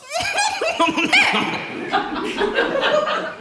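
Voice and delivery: pleased-with-himself cackle; hearty laugh at his own joke. Acted. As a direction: laugh big at your own gag.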